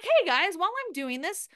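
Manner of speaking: upbeat story host. Shown as a demonstration. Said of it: "being like".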